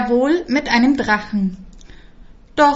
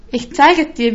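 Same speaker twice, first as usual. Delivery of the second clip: insistent; 5 semitones higher, 6 dB louder than usual. A narrator's reading. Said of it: "wiederholte der Prinz".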